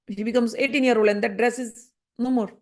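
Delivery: ordinary talk, nothing marked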